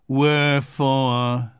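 slow, drawn-out mournful Eeyore; gloomy donkey. A narrator's reading. Eeyore musing alone in his corner, slow and gloomy. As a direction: stretch it out, heavy and sad.

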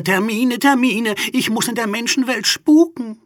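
high, loud, fretful exclaiming; flustered calling out. A narrator's reading. Ghost remembers she must go haunting, high flustered cry. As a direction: fret loudly at a high pitch. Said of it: "rief Igitta".

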